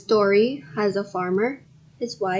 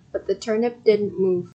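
usual speaking voice

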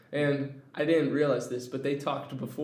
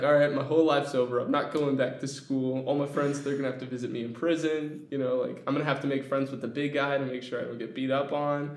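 panicked rush; faster than usual. Retold or imitated. Panicked kid thoughts, rushed.

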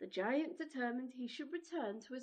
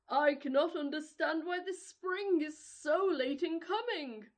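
giant voice; 4 semitones higher, 6 dB louder than usual. Acted. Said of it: "said the selfish giant".

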